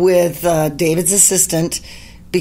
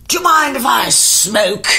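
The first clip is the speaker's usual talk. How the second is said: a lightly put-on voice, higher pitched; 4 semitones higher than usual. Singer asking two non-smokers in the car, lightly imitated. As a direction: play the singer politely asking.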